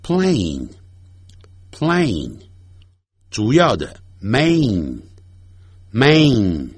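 plane and main said slowly with long A; slow repeat. Shown as a demonstration. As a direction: repeat each word slowly for the class. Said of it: "所以我再念一遍". (慢速重复示范)

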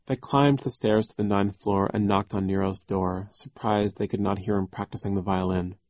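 ordinary delivery

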